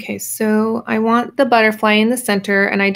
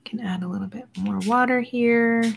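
soft whisper while working; whispering to herself; 2 semitones higher, 5 dB quieter than usual. Real, not acted. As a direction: whisper it like a private note while painting. Painting, muttering to herself.

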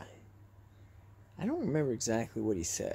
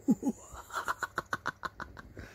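high whispered creepy giggle; creepy laugh; 13 semitones higher than usual. Pretend. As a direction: cackle like a ghost. Spooky filter laugh.

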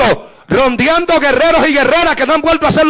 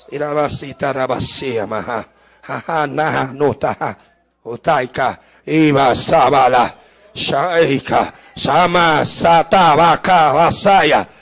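low, soft, murmured flow of tongues, much lower and softer; speaking in tongues; 3 dB quieter, slower than usual. Real, not acted. Preacher before the congregation, praying in tongues in a low murmur. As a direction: pour out a slow, hushed stream of prayer syllables.

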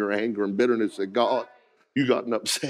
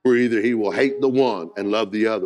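ordinary talk, nothing marked

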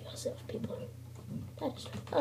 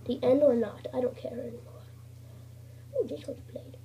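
ordinary talk

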